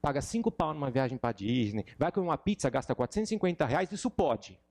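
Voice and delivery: heated rant. Real, not acted. Ranting about dictionaries.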